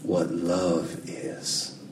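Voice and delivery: low, slow, bad Southern accent; halting movie-character accent, low and badly faked; Forrest Gump. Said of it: "that was the worst accent ever".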